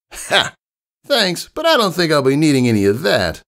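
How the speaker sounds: high, cocky tomboy voice; high brash tomboy pony; brash pegasus. Acted. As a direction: play the confident young flyer explaining. Rainbow Dash voiced in story.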